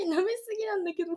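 ordinary talk

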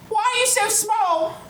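put-on very high little altar boy voice; squeaky kid asking bluntly; tiny high child voice. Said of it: "he goes".

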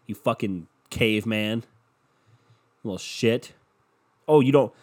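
ordinary talk

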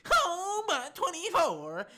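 high, drawn-out fake wail; mock whining. Mimicking a fan moaning over a playoff loss, mock crying. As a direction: whine it like a crybaby fan. Mocking fans' whining excuse.

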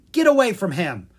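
loud, sharp warning call; calling across the room. Acted. Aspen warning Zed off her monkey, calling loudly across the room. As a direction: stop him from across the room. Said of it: "Aspen called across the room".

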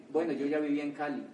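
ordinary talk, nothing marked

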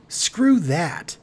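defiant. A narrator's reading. Marcy Marcy dismissing her friend's refusal, brashly defiant. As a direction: throw it back defiantly.

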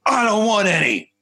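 man at the trailer door, curtly dismissive; gruff dismissal. Retold or imitated. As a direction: brush the kid off curtly. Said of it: "he slams the door".

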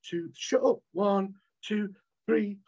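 mock a beginner losing patience; lightly voiced irritated beginner meditator; frustrated meditator. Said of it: "you're gonna be like".

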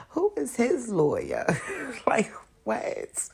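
whispered through giggles; laughing whisper. Real, not acted. Whispering to viewers about his lawyer, giggling. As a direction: hush the words while laughing.

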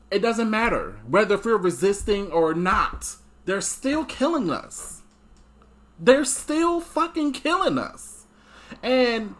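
high, slow, furious and anguished; angry. Real, not acted. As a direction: cry it out in outrage. Rising anger about police killings.

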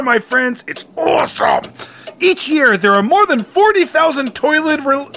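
normal talking voice

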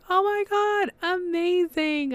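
very high, stretched-out squeal of amazement; so excited. Retold or imitated. Podcaster reliving getting the nomination news, so excited. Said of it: "I'm so excited".

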